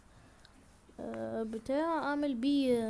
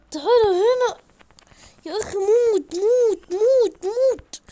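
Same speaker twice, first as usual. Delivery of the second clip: high, loud yells of die; yelling at enemy; 9 semitones higher, 11 dB louder, slower than usual. Real, not acted. Gamer hitting the skeleton, yelling at it to die. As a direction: shout the monster down, frantic.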